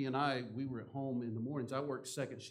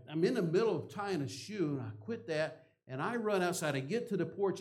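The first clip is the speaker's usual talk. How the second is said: ordinary talk, nothing marked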